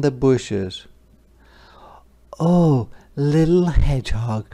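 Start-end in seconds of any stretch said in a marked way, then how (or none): 2.4-4.5 mother deer voice. Acted. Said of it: "she asked".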